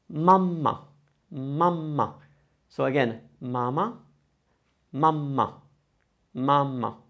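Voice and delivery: mamma with a stretched first a and a held m; double m. Shown as a demonstration. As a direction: lean on the first a of mamma, then land on the m. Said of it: "pick a lot more with the vowel before".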